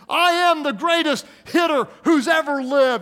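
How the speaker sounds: high, loud shout of a cocky little slugger; boastful kid. Acted. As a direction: proclaim it like a kid hyping himself up. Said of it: "it's like the kid who".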